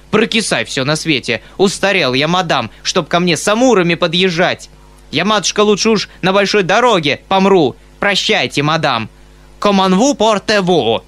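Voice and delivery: loud, high huffy rant; indignant tirade. Acted. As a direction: storm off in indignation. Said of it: "дрожа от негодования".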